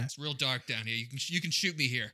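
mock sinister. Villain luring the cop into the hole, hushed.

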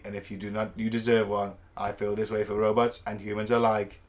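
low, mechanical robot read. Acted. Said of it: "I was trying to do it in a robot voice".

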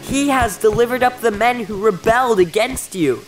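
high-pitched, exultant messenger; gleeful declaration. A narrator's reading. Messenger bringing the king news of victory, gleefully declaring. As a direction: proclaim the victory with glee, stretching the opening. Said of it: "waving and jumping with glee".

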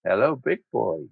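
low mock come-on; flirtatious woman. Woman coming on to a man, mock seductive. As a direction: imitate a temptress greeting her target. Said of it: "women like that".

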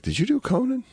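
high put-on voice of the dad; imitating her father. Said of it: "he was like".

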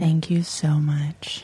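low, slow, hushed radio delivery; smooth radio voice. Shown as a demonstration. Radio graduate thanks cohost for the compliment, smooth radio voice. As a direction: purr it like a late-night radio host. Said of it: "develop a very smooth delivery voice".

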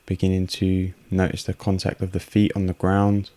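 unhurried meditation voice with long pauses; guided meditation. Real, not acted. Host guiding listeners through a breathing meditation, calm and unhurried. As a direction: guide calmly in short, evenly pitched phrases, leaving space for breath.

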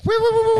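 high loud whooping catcall woos; hammed-up wolf whistle woos; mock catcall hoots. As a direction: hoot it out like a catcaller.

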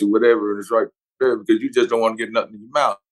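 usual speaking voice

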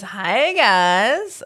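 loud, swooping hello; exuberant read-out greeting. Acted. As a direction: call it out with gusto. Reads out a listener's greeting.

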